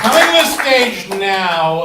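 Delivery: loud, slow introduction; big emcee build-up; emcee intro. Real, not acted. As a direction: stretch it out announcing the comic. Host introduces next comic.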